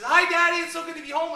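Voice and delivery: put-on a little girl happily greeting her daddy; excited little girl. Daughter greeting dad at the door, high excited child voice.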